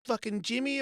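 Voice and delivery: imitating a father's weak telling-off; dad protesting. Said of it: "he goes".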